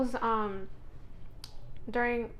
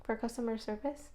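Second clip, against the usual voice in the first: usual speaking voice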